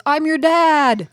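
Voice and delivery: mock-tearful dad, stretching the words slowly; hammed-up emotional outburst. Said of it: "he's very emotional. He's tearing up".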